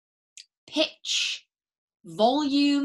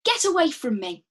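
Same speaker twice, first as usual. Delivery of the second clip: higher and louder, pushing away; loud get away; 5 semitones higher, 4 dB louder than usual. Shown as a demonstration. Teacher modelling a line for students, loud and urgent. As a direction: shout it to drive them off. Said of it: "maybe say it back to me".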